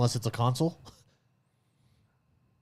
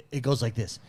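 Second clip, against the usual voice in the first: ordinary talk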